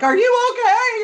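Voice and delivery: high, loud, scared squeak through a laugh; imitating terrified. Friend checking on her after she fell, terrified high squeak. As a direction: play him panicked that she's hurt. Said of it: "s-, terrified".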